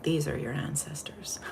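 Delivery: soft unvoiced whisper of "These are your ancestors."; whispered message. Retelling the voice from her meditation, in a hushed whisper. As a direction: breathe it like a secret voice from beyond. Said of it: "And I was told".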